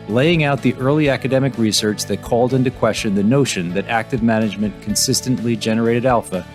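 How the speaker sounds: projected voice-over for an episode preview; podcast intro read. Real, not acted. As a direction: read the episode intro with firm, measured emphasis. Pre-recorded podcast introduction.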